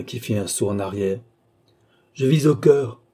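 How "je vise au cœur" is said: cold, higher and louder answer; cold reply. A narrator's reading. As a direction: state it coldly, with force. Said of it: "répondit froidement Felippone". (réponse froide et appuyée)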